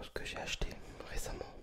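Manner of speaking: normal talking voice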